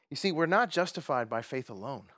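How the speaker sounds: ordinary talk, nothing marked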